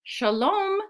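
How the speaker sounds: high-pitched storybook hello; calling a greeting. A narrator's reading. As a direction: sing out a greeting to passers-by. Voices story characters greeting someone.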